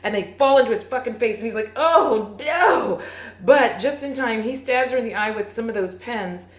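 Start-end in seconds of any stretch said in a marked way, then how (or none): 1.8-3.0 high, louder "oh no"; a hammed-up yelp of alarm; cartoonish panic; mock alarm. Elijah hit by bugs.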